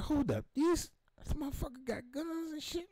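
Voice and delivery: high alarmed whisper-ish exclamation. Retold or imitated. Telling a friend about the cop's house, high alarmed. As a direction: retell the panic as it hit. Panicked realization about guns.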